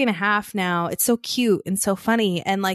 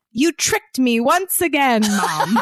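laughing; 5 semitones higher, slower than usual. Retold or imitated. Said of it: "it cracks me up".

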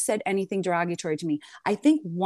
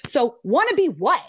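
mocking retort; 4 semitones higher, 5 dB louder than usual. Mocking the rival's insult.

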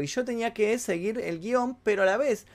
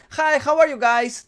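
casual street-style hello, voiced up and loud; relaxed everyday greeting; 8 semitones higher, 7 dB louder, slower than usual. Shown as a demonstration. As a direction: greet them as you would in the street. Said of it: "como una cosa más relajada".

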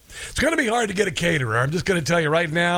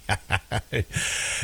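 soft, low chuckling laugh; real laugh; 7 semitones lower, 7 dB quieter than usual. Real, not acted. Host amused by debate ratings going down precipitously, soft laugh. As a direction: laugh quietly under the breath.

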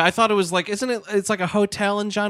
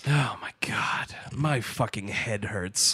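low, slow exasperated whisper; 8 semitones lower, 8 dB quieter, slower than usual. Real, not acted. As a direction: breathe it out, head aching.

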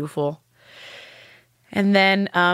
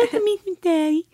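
put-on much higher little-girl voice; imitating her daughter; 11 semitones higher than usual. Daughter naming her happy place, high child voice.

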